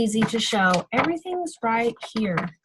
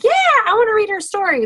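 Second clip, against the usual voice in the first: high, loud, quick burst of enthusiasm; acted eager reader; 11 semitones higher, 5 dB louder, faster than usual. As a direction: jump in like a keen reader. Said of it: "I want 'em to look more like".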